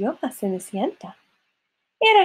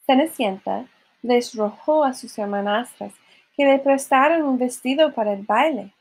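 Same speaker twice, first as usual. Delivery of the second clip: plain reading voice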